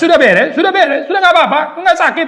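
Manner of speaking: high, laughing "sudah beres", brushing the pain off; mock self-deceiving reassurance. Mocking people who tell themselves all is fine. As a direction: chirp it like nothing hurts. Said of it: "kita menipu diri dengan mengatakan".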